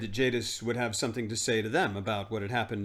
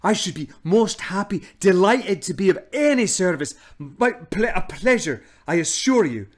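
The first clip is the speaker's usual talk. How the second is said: put-on fawning old man; 7 semitones higher, 8 dB louder than usual. Uncle Andrew fawning.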